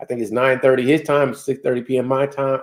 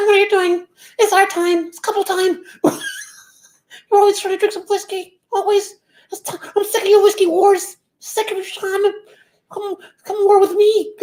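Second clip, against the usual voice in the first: very high falsetto wife impression; comic falsetto, laughing at the edges; mock nagging wife. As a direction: put on a squeaky voice as the fed-up missus. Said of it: "that the missus said".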